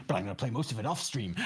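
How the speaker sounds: low, quick-dropped wrestler aside after the hype; wrestler's aside. Shown as a demonstration. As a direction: duck down into a confiding aside. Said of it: "You gotta have little asides".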